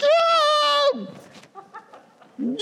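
very high, loud imitated shriek; mock scream on coming to. Preacher mimicking fainted riders waking mid-ride, mock scream. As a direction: burst back into screaming, hammed up. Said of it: "all of a sudden, they'll come to".

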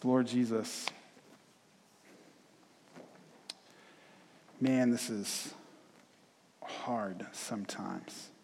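soft, hushed pastoral prayer; quiet prayer. Acted. Pastor leads congregation in closing prayer, soft and hushed. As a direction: pray gently, sinking toward a whisper.

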